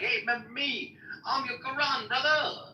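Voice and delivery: high, hammed-up street bro; bro Quran voice. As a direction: play the Quran as a friendly homie.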